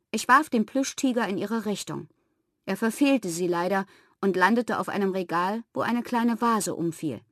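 usual speaking voice

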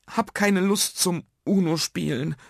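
understated weepy sulk; sobbing child. A narrator's reading. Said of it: "schluchzte Nuno".

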